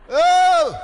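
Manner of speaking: loud, high drawn-out "oh"; imitated prayer cry. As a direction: mimic an overcome worshipper's cry. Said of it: "he just went".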